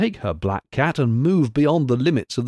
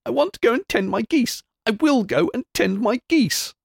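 put-on much higher wailing child's cry; sturdy tearful wail of a spoiled girl; crying louder; 12 semitones higher than usual. A narrator's reading. Mayor's daughter demanding her geese, high wailing cry. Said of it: "cried louder and more sturdily".

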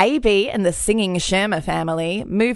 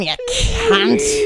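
mock outrage; 13 semitones higher, 3 dB louder than usual. Scolding jokingly.

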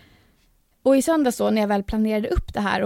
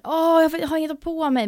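mock stressed wail; 7 semitones higher than usual. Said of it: "du kanske gjorde så här".

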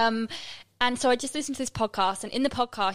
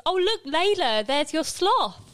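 मज़ाक में ऊँची आवाज़ में इम की नकल; bright high call to a child; imitating Im; 7 semitones higher, 3 dB louder, slower than usual. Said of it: "and Im goes".

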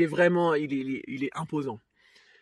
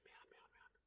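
whispered, barely voiced panic; panicked whisper; 39 dB quieter, slower than usual. Real, not acted. Alone on the boat with him, whispering scared swears. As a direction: breathe the swear words out in fright.

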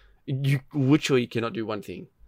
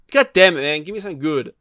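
mock frustration; 4 semitones higher, 5 dB louder, faster than usual. Frustrated at pulls.

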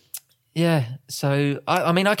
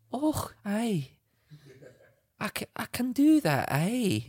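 higher-pitched mock Scottish wife; bad Scottish accent; 4 semitones higher than usual. Husband plays his wife agreeing to pamper him, bad Scottish accent. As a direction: put on a hammy Scottish woman's voice. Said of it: "that is the worst Scottish accent".

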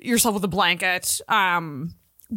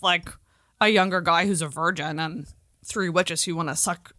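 ordinary talk, nothing marked

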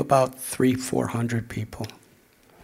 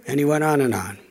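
normal talking voice

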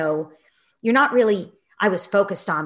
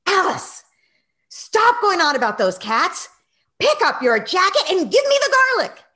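loud, high exasperated snap; snapped; 13 semitones higher, 9 dB louder than usual. Retold or imitated. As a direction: lose patience with the child. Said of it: "And I snapped".

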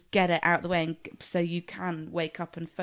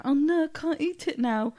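higher, slow whining; whiny little brother; 9 semitones higher, 3 dB louder than usual. Retold or imitated. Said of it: "he was like".

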